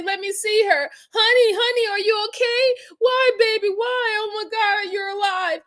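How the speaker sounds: high-pitched imitation of a pleading, tearful husband; mock crying. Commentator imitating a husband rushing to his wife, mock crying. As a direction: plead like you're putting on tears for your wife. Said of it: "crying and trying to run in there".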